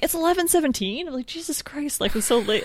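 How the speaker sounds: high-pitched disbelief, acted out; retold alarm. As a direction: replay being startled by the late hour. Retells shock at the late time.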